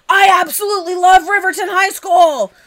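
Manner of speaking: loud, high, over the top rave; gushing review voice. Retold or imitated. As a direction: gush it out like a fan. Mock gushing five star review.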